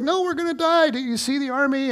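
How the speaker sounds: hammed-up panicked high wail; panicky squeal. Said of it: "the other guy is panicking".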